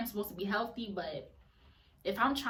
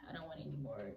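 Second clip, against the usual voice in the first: low, much softer echo of the line; resigned repeat; 7 semitones lower, 12 dB quieter, much faster than usual. Real, not acted. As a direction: let the repeat sink down under your breath. Repeats the line dropping right down.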